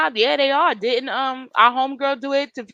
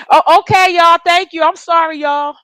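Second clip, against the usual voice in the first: excited apology; 7 semitones higher, 8 dB louder than usual. Real, not acted. Embarrassed laughing apology to viewers.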